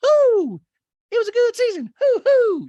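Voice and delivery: very high, loud hooting falsetto; Asher. Retold or imitated. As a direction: hoot it out in falsetto. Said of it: "having Asher come out and be like".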